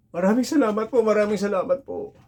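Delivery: high, eager, gushing thanks; overjoyed thanks. Retold or imitated. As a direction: thank the spirit over and over, thrilled. Grateful friend thanks the spirit.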